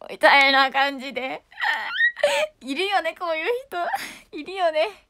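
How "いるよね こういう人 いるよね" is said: ordinary talk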